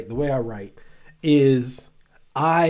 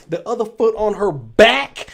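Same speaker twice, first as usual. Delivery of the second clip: "back" hit with a burst of volume; stress on BACK; 3 semitones higher, 5 dB louder than usual. Real, not acted. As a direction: punch "back" loud at the end. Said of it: "why do I keep emphasizing the word back".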